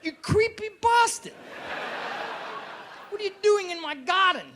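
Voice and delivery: high-pitched outraged woman; mock-indignant, high squawk; alarmed woman. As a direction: shriek back at a creepy admirer. Woman reacting to creepy suitor.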